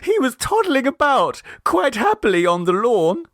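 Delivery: put-on high, anxious young mother's voice; tearful mother. A narrator's reading. Mrs Mummerby recounts the lost baby to Clovis, high and tearful. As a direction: fret in a high mother's voice, a touch teary. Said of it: "said Missus Mommerby tearfully".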